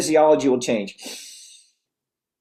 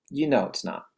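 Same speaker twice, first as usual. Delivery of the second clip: ordinary talk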